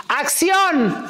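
"¡Acción!" called out high; calling action. Retold or imitated. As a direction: call action on set, lifted high. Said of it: "me dice". (grito de acción en el set)

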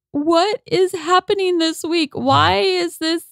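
high, slow, drawn-out lament; acted dismay. Retelling her Instagram video after the chicken's death, dismayed plea. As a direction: plead at the universe, reliving it.

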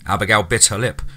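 ordinary delivery, nothing marked

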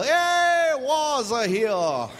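high, loud yell, stretched slowly. Retold or imitated. Schoolkids tease arriving family, high slow loud yell. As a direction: call it out across the school yard. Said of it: "everybody would yell".

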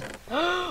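sharp, high intake of breath; startled gasp. Real, not acted. Player startled during the game, sharp gasp. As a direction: gasp in sudden shock.

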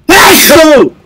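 a full, loud sneeze that bursts out; loud real sneeze. Real, not acted. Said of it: "I'm sneezing!".

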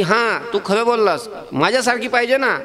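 put-on a cheeky boy proud of his reasoning; child Krishna explaining. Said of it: "Yashoda मैयानं सांगितलंय की".

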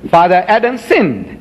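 ordinary talk

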